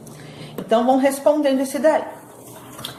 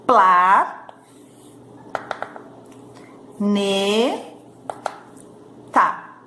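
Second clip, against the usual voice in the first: "Pla, ne, tá" in three separate syllables, long pauses between; 3 semitones lower, much slower than usual. Shown as a demonstration. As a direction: count out "Pla, ne, tá" sound by sound. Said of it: "Quantas vezes eu vou abrir a minha boquinha". ("Pla, ne, tá" sílaba por sílaba, com pausas longas)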